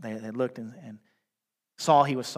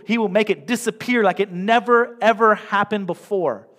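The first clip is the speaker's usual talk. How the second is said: raised, higher, louder preaching; 6 semitones higher, 7 dB louder than usual. Acted. Pastor urging the church to celebrate, at full preaching force. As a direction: fire up the congregation with each line.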